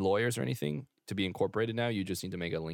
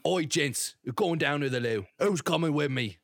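higher, louder English geezer; hammed-up English hard-man call; Jason Statham; 5 semitones higher, 5 dB louder than usual. Calling gents along to the loo, English geezer. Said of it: "Jason Statham".

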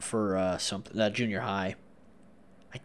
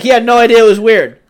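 usual speaking voice

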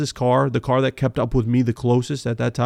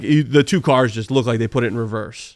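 ordinary talk

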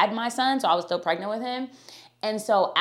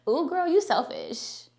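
high, slow, sassy put-down; mock sassy scolding; 5 semitones higher than usual. Mimicking people who call goddesses selfish, sassy. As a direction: tease them like a judgy friend calling you out. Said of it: "Have you ever heard that?".

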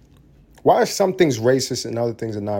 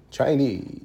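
mock Chinese accent. Mock accent naming languages.

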